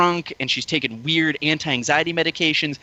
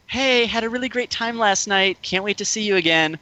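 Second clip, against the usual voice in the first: higher-pitched mock perky voice; cheery date's text; 6 semitones higher than usual. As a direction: read it out imitating her oblivious chirpiness.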